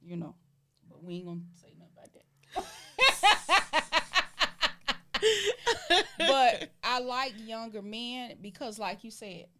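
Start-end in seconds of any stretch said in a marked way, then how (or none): 2.5-6.1 loud, high burst of laughter; long staccato cackle, high and loud; big laugh. Real, not acted. Shared joke about what stays unsaid.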